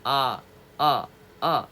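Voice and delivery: slow 아 with the mouth opened wider; wide open ah. Shown as a demonstration. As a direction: stretch 아 out, jaw wide. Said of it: "얘보다는 크게 벌려야 돼". (입을 크게 벌린 느린 아)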